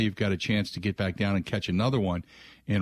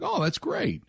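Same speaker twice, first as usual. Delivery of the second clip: mock delight; 12 semitones higher than usual. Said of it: "I'm like".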